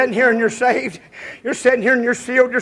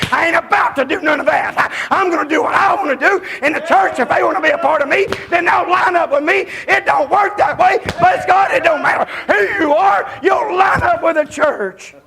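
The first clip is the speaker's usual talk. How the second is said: mock stubborn churchgoer; 5 semitones higher than usual. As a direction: voice the proud member's stubborn boast. Mocking a stubborn churchgoer, shouting.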